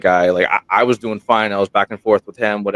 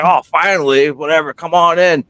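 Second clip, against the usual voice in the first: mock exasperation; 8 semitones higher, 4 dB louder than usual. Teasing late friend.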